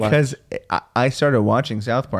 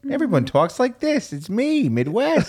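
high, hammed-up Midwest accent; 6 semitones higher than usual. Host mocking the default accent, Midwest accent. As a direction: overdo a Midwestern twang, pitched up. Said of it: "Midwest, like, this is the default accent".

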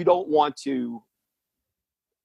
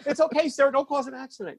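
mock reassuring; 12 semitones higher than usual. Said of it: "And I'm like".

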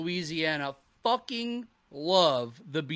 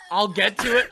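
ordinary talk, nothing marked

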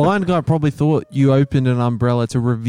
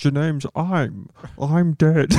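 Sean Connery; much slower than usual. Pretend. Friend joins Connery impressions.

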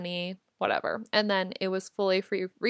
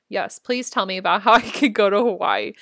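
laughing; 8 dB louder than usual. Retold or imitated. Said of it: "And I go".

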